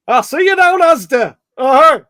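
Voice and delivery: comic [name] impersonator with a broad Birmingham twang; Birmingham-accented [name]. Retold or imitated. Host imitating a local [name] act, Birmingham-accented [name] impression. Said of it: "like, a Birmingham accent".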